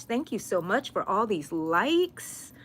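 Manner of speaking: ordinary talk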